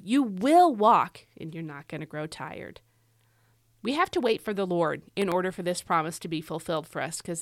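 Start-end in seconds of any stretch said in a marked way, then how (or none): 0.0-1.3 slow, with "will" swung up strongly; emphatic, drawn-out "will"; stress on WILL. Shown as a demonstration. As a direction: stretch and punch "will". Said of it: "all the times in this verse where God says will".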